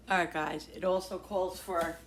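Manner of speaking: ordinary delivery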